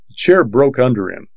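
ordinary delivery

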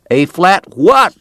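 cowboy at the piano calling back; shouting back. Acted. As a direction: yell back in confusion. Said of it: "the cowboy shouted back".

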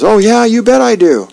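a lightly voiced eager little boy; eager kid. Neighbor boy eager to hear Dagwood's story, lightly voiced kid. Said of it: "And Elmo goes".

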